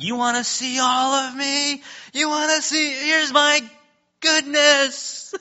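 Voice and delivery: hammed-up almighty God announcing himself; mock almighty God. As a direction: ham up a grand almighty God. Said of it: "just some almighty God and you're like".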